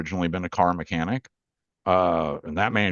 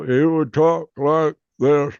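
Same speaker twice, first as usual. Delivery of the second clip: imitating very slow, labored talk, louder and higher; slow speech; 9 semitones higher, 5 dB louder, slower than usual. Elder talking after his stroke, imitated slow speech. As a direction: drag each word out, pitched up and loud. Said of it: "although it was slow".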